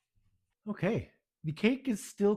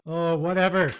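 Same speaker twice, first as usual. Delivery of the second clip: exasperated; 4 semitones higher, 11 dB louder than usual. Real, not acted. Cupcakes failing, exasperated outburst.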